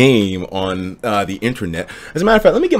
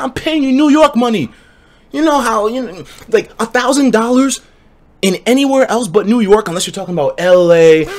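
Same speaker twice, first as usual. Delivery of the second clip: high, heated, speeding rant; triggered rant; 9 semitones higher than usual. Real, not acted. Streamer ranting to chat about barbers, heated and high. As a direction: vent about barbers with rising heat. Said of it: "I get a little bit triggered".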